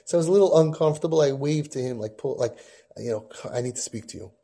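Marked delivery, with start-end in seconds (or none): 3.5-4.3 hushed aside. Retold or imitated. Secret signal to grandfather.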